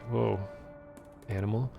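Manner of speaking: normal talking voice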